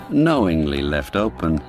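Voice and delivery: usual speaking voice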